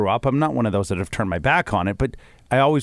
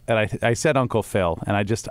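ordinary talk

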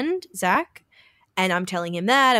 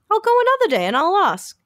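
higher and louder, eager promise; excited resolve; 11 semitones higher, 5 dB louder than usual. Real, not acted. Telling her co-host she'll go back and ask, higher and louder with excitement. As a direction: declare it like a fresh plan.

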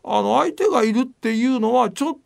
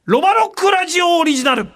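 hype announcement; 10 semitones higher, 3 dB louder, slower than usual. Acted. Hyped merch announcement, shouted finish.

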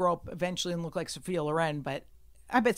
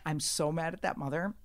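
ordinary talk, nothing marked

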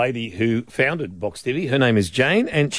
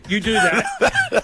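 laughing; much slower than usual. Real, not acted.

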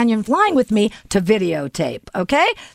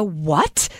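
taken-aback what; 9 semitones lower than usual. Retold or imitated. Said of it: "I go".

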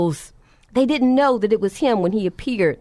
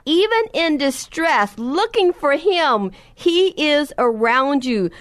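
higher, slower pleading sermon close; preaching appeal; 5 semitones higher than usual. Real, not acted. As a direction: reach out to the congregation, drawn out. Closing appeal to the listeners.

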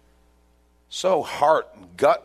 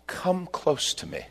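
gentle invitation; 5 semitones higher, 5 dB quieter, slower than usual. Acted. Joseph inviting his brothers.

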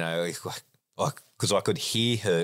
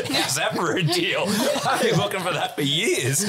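high, loud, laughing outburst; laughing disbelief; 5 dB louder than usual. Real, not acted. Man joking to friends about a free-money deal, laughing. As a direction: crow it through laughter.

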